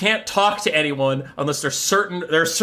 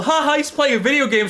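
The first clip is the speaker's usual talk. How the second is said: higher, mock playful commentary; normal gamer screaming; 10 semitones higher than usual. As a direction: make light of it in a high voice. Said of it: "screaming like normal".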